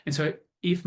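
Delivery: normal talking voice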